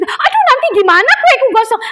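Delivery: high-pitched fussing over a cake; mock fretting. Mock worry about burning cake. (menirukan keluhan cemas dengan nada tinggi)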